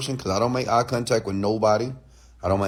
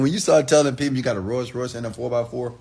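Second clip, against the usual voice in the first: usual speaking voice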